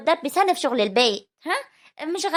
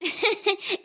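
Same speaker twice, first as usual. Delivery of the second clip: laugh; 6 semitones higher than usual. Acted. Mother laughs at daughter's idea.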